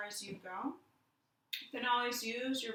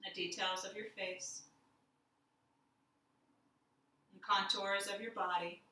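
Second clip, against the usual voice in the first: guided meditation. Acted. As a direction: lead them inward gently, letting silence sit. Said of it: "we'll do a little guided meditation".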